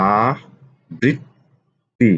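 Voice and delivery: আবৃত্তি pulled out very slowly; drawn-out spelling. Shown as a demonstration. Teacher to students, slowly stretching আবৃত্তি. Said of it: "teacher stretches word for students".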